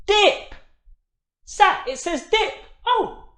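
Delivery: high, loud thrilled cheer; excited. Acted. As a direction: burst out with the answer. Child blends word, excited.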